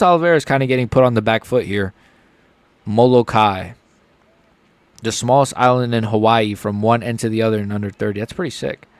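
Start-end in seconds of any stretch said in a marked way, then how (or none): none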